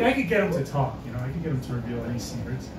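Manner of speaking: usual speaking voice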